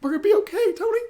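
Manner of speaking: a hint of Pepper reassuring her dying husband; mildly voiced wife telling him they'll be okay; Pepper's farewell. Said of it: "and says".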